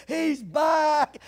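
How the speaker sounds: high, loud excited shout; overjoyed dog. Acted. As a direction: burst out like a dog greeting its owner. Voices overjoyed dog greeting owner.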